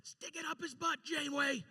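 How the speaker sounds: high, gleeful cheer at the screen; fan cheering. Retold or imitated. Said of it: "and I go".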